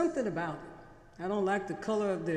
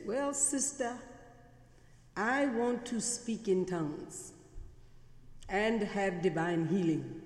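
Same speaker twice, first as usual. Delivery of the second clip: put-on light Singaporean accent, slowed; a hint of Singaporean English. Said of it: "I'll try to use my best Singaporean accent today".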